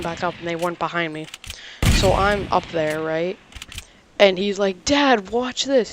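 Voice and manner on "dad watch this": calling out. Retold or imitated. Kid calls narrator dad.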